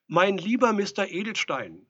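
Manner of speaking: high, loud, lecturing address; calling through door. A narrator's reading. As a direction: address him loudly from outside.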